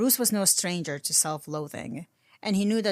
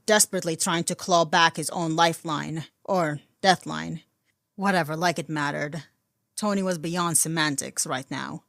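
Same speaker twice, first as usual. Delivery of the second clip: ordinary delivery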